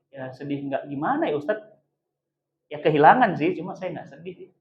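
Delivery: low, very quiet hesitant reply; subdued admission. Acted. Child admits little grief to ustaz, subdued. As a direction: admit it softly, almost to yourself.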